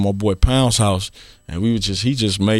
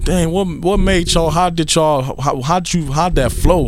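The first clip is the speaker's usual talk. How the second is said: retold amazement, pitched up; higher, stumbling over the eager question; amazed questioning; 6 semitones higher, slower than usual. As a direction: imitate an awestruck fan asking how it's done. Retelling his amazed question to [name].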